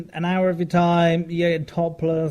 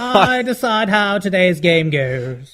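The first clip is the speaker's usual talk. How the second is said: pompous bachelor king; 4 semitones higher, 6 dB louder, slower than usual. Pretend. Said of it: "I could see you".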